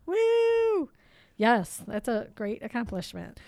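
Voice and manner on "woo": high celebratory whoop. Real, not acted. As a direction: squeal a happy woo. Celebrating episode 250.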